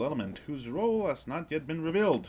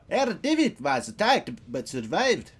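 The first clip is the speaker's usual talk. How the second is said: louder, shaky German accent attempt; 4 semitones higher, 6 dB louder than usual. Reading a German character's line, clumsy German accent. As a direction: try a German accent, badly. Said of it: "I can't do accents".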